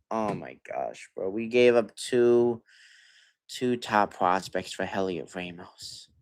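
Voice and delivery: ordinary talk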